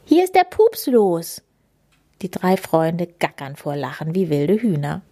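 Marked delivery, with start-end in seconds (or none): 0.0-1.4 amused squeal. A narrator's reading. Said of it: "Lou quiekt belustigt".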